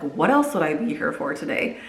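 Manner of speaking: ordinary delivery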